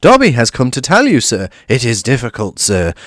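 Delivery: nervous little house elf; lightly voiced anxious elf; hesitant elf. A narrator's reading. Dobby hesitant.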